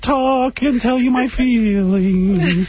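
high, slow nasal whine; nasal whiny voice. Retold or imitated. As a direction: drag every word out nasally. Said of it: "In a very nasal voice".

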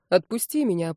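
ordinary delivery, nothing marked

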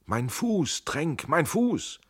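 high, drawn-out cry of pain; whimpering in pain. A narrator's reading. Injured Schell to Tränk below the wall, wailing. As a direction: wail to your comrade for help. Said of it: "Da hört er es wimmern".

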